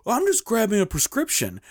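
high-pitched mimicked excuse; defensive excuse. Driver explaining his parking, defensive.

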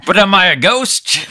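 high, urgent in-game protest; mock-alarmed protest. Player protests while being stabbed.

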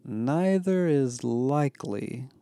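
slow, drawn-out sarcastic echo; bitterly sarcastic. A narrator's reading. Barrett echoes Isaiah's poor reassurance, bitterly sarcastic. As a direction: repeat it slowly, dripping bitter sarcasm. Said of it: "repeated slowly, his voice bitter with sarcasm".

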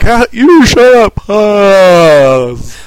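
the wizard cuts him off; hammed-up Gandalf retort; snapping Gandalf. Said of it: "Gandalf, the wise wizard, he's like".

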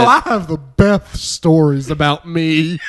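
impression of a friend. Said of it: "your impression of him your impression of me".

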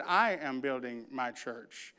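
low, soft, assured; calm certainty, almost under the breath; a quiet, confident reply to the doubters; quiet Jesus quote. Acted. Jesus quoted after talking back.

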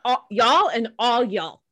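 "all, y'all and all y'all" said slowly, each "y'all" drawn out; slow drawled y'all. Shown as a demonstration. Showing the hosts her favorite word, drawn out slowly. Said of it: "Why would you say y'all versus all y'all".

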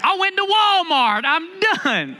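high, whiny "Lord, I'm done"; hammed-up hopeless complaining; mock despairing disciples. Disciples protesting Jesus, pitched high in mock despair. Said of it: "They're like".

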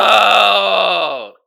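loud groaning oh at the writing; loud groan of frustration; exasperated shout of oh. Real, not acted. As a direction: let out a loud fed-up oh. Exasperated outburst.

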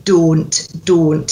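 "Don't" with a pure long O; Scottish "Don't", modelled for a learner; Scottish O sound. Shown as a demonstration. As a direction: say "Don't" with one unglided O, each evenly. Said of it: "we need to get that O sound".

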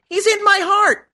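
very high little-pupil voice; pupil calling out. Acted. Mary answers the teacher in class, very high acted call-out. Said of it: "Mary called out".

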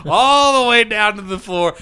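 ordinary talk

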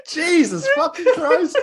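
slow, high-pitched words through laughter; real laughing disbelief; helpless laughter. Real, not acted. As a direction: gasp it out while cracking up. Cracking up at the accent.

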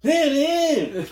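high excited yelling greeting; excited high shout. Retold or imitated. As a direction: yell a high, cheery hey man. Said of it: "but he's like".

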